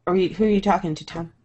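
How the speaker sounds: ordinary talk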